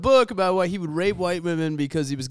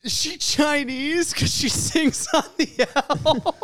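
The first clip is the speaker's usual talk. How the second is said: much higher, slow, put-on innocent tone; mock innocent question; 14 semitones higher, much slower than usual. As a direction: ask it with fake wide-eyed innocence. Deadpan joke question.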